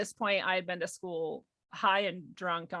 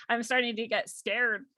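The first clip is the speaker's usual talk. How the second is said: usual speaking voice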